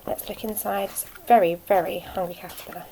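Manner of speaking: ordinary delivery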